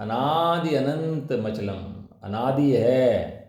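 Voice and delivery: each word stretched out slowly; slow drilling of the verse words; slow emphatic recitation. Shown as a demonstration. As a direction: spell it out slowly so they memorise it. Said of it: "बड़ा याद करना".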